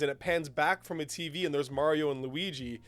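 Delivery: ordinary talk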